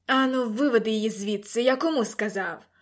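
mildly angry command; a firm, impatient lord's order; barked order. A narrator's reading. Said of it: "гаркнув лорд Аластер". (сердитий наказ)

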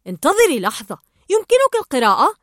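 high, loud astonished question; surprised question. Acted. Marlin realizes Dory can read, surprised. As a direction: stop short in disbelief. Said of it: "إلا أن مارلين توقف قائلًا".

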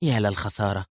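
soft, understated regret; murmured. A narrator's reading. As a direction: mutter it through a slight pout. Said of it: "مطت شفتيها مغمغمة". (مغمغمة بصوت خافت)

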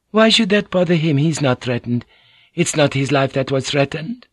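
dismissive samurai wife speaking sharply; Fujiko's voice. Acted.

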